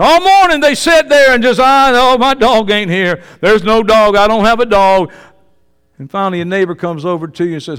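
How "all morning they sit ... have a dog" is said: loud, raised-pitch mock lament; exaggerated complaining. Preacher mocks owner sitting all morning without his dog, exaggerated complaint. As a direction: bemoan it at full volume, hammed-up.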